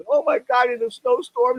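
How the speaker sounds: high-pitched retold fright; alarmed exclamation. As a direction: blurt it out in mock alarm. Acts out his alarm on waking.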